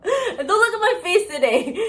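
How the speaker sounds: high laughing wail, half crying; laugh-crying through the words; a mock wail of despair, high and laughing. Real, not acted. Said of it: "Please, don't give me a crisis".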